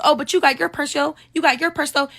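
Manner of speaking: imitated high, needling taunt; mock her sing-song jab about the purse; high mimicked resentful taunt; taunting friend.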